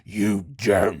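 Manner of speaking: loud, altered sinister voice. Acted. Landlord refusing access to the tower, hammed-up sinister. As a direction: warn like a stage villain. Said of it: "in the most sinister way possible".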